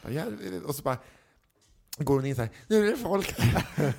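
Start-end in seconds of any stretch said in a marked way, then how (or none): 2.7-3.9 flustered waitress. Retold or imitated. Waitress mocks the crowd.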